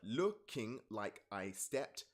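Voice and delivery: very slow, each syllable spaced out; slow syllable-by-syllable read; counting syllables slowly. Shown as a demonstration. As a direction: tap out every syllable of the line. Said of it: "Fifteen syllables".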